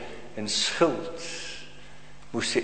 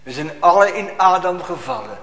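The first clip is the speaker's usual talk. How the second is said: ordinary delivery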